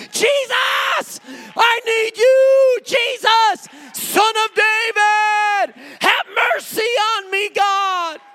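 very high, loud, stretched-out plea; desperate shouting. Acted. Worshipper refusing silence, crying to Jesus, shouting. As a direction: cry out to God at full voice.

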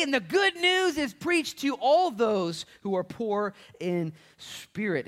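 loud, high and slow proclamation; triumphant pulpit cry; full-force preaching. Real, not acted. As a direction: stretch each word out for the congregation.

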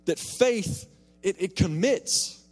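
loud, high, impassioned preaching; fervent preacher's build to the point; preaching at full force. Acted. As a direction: drive it home from the pulpit, slowing on the key words. Preaching the sermon's climax.

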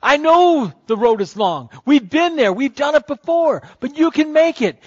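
a lightly voiced cloud of witnesses calling encouragement; mild cheering crowd. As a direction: cheer him on like the crowd in the stands. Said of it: "Can you hear 'em saying".

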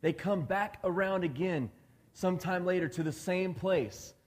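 normal talking voice

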